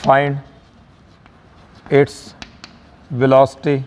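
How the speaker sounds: isolated words with long pauses; slow, separated classroom dictation. Shown as a demonstration. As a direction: dictate it for students to copy. Dictating the problem word by word.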